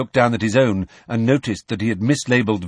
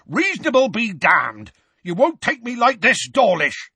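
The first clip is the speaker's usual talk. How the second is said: high-pitched, growling roar of defiance; defiant roar; 11 semitones higher than usual. A narrator's reading. Hagrid refusing arrest, defiant roar. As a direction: roar back at your attackers. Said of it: "Hagrid roared".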